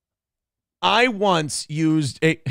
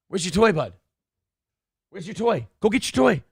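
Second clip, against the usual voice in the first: eager dog coaxing; 3 semitones higher than usual. Real, not acted. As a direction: get the dog going. Urging dog to fetch.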